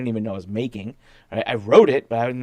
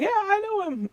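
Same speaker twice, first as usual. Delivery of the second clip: desk guy. Retold or imitated. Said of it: "the guy behind the desk was like".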